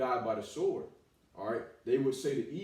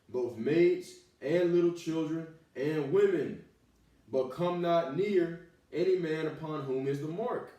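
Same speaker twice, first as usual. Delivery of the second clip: slow, loud, raised preaching; fiery scripture reading; 2 semitones higher, 4 dB louder, slower than usual. Acted. Preacher proclaiming the Lord's orders, slow, loud and raised. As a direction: lean on every word of the slaughter.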